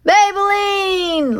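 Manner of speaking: long, high, drawn-out shout. Acted. Leroy calling his horse in the rain, drawn-out shout. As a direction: call her name slowly across the dark. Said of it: "Leroy shouted".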